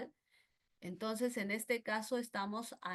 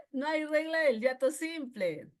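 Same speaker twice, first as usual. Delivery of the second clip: mock student voice, a bit higher; student's answer; 5 semitones higher, 4 dB louder than usual. (voz de alumna)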